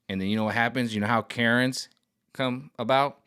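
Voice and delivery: ordinary delivery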